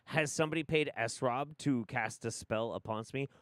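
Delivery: normal talking voice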